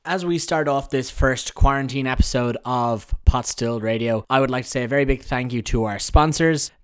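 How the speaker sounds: upbeat sponsor read. Acted. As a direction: sell it like a radio ad. Host reads sponsor messages.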